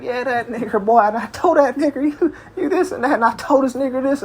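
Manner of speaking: high-pitched mimicry of a chatting coworker; imitating young coworker. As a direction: imitate his casual talk, pitched well up. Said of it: "this is how he normally speak".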